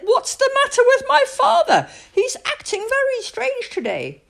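put-on high, baffled girl voice, half laughing; puzzled Julie. Julie asks why her father acts strange, high and laughing. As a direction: wonder aloud at dad, laughing. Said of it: "And Julie said".

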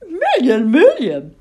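high, loud little owl amazement; piping, gobsmacked child-owl question; astonished gasp. Acted. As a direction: echo it incredulously as a stunned owlet. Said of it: "gasped Plop".

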